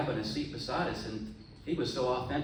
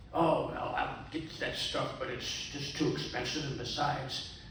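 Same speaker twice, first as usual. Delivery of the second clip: pirate voice; 3 semitones higher than usual. Acted. Old pirate telling the young man at the bar, in a hammed-up pirate voice.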